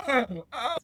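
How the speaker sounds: high, pained whine of disgust; a hammed-up, high-pitched "oh, the worst"; mock disgust. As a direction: groan it out like you can't bear the sound. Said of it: "and you'd be like".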